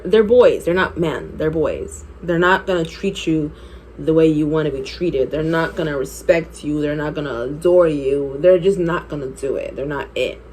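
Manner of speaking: ordinary delivery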